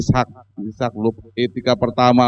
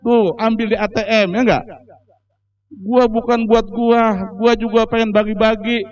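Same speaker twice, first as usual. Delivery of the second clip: father's voice; 8 semitones higher than usual. Retold or imitated. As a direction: tell them to take the money. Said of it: "Kata bapaknya".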